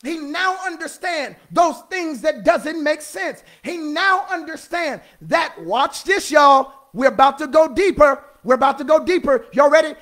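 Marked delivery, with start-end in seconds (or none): none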